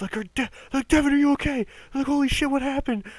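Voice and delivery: very high, loud mock panic; frantic high-pitched concern, hammed-up; alarmed coworkers. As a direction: imitate worried people rushing in. Alarmed coworkers rushing in, acted out.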